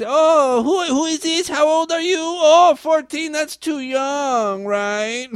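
high mock accent, playing dumb; foreign guy voice. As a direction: ham up a feigned innocent chat guy. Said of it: "They act".

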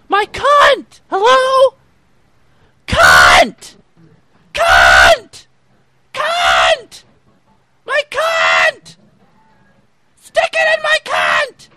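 yelled crude lines, rising high; shouting. Acted. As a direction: scream the words to provoke. Shouting to test if heard.

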